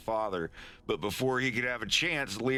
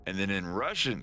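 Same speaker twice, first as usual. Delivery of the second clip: plain reading voice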